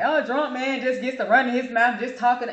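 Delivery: put-on a touch of drunken ranting; drunk man rant. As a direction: mimic a man running his mouth. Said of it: "That's how he sounded".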